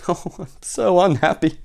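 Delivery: theatrical tearful moan; mock sobbing. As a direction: wail it as a weepy storybook king. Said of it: "He keeps sobbing".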